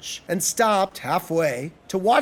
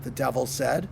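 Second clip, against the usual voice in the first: ordinary delivery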